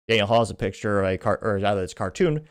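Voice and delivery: ordinary talk, nothing marked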